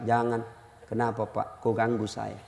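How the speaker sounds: low, very soft mock refusal; hushed exchange. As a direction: murmur a little two-person dialogue. Acted hushed exchange between neighbours.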